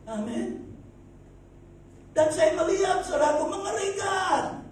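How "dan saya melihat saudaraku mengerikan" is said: full-force preaching. Acted. Preacher telling congregation of a horrifying landslide, loud proclamation.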